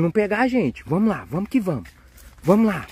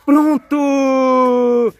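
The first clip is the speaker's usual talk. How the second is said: loud, drawn-out celebration; triumphant cheer; 6 semitones higher, 5 dB louder, much slower than usual. Pretend. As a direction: shout it high, stretching the words in victory. Celebrates returning the eggs. (comemoração gritada e esticada)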